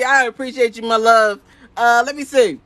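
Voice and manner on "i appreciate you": ordinary talk, nothing marked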